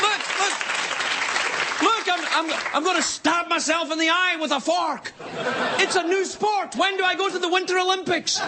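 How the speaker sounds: high-pitched shouting daredevil; hammed-up thrill-seeker, very high throughout; manic mock enthusiasm. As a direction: show off like an overexcited stunt idiot. Acting an eager extreme athlete.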